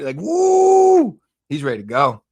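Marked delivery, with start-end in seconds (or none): 0.2-1.2 a high, loud whoop imitating the fighter; high loud mock whoop; hype yell. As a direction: whoop it up like a hyped fighter walking out. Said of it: "He's like".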